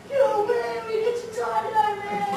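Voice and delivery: put-on high, thrilled kids' cheer; excited. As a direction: squeal it out like delighted kids. Excited kids at tie-dye surprise.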